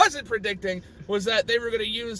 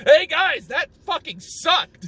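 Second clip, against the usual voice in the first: loud, higher heckler's call; Foreman Spike; 5 semitones higher, 4 dB louder, slower than usual. Acted. Construction boss jeering at the brothers' commercial, loud mocking voice. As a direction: call it out, then drag the insult slowly. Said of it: "Foreman Spike's like".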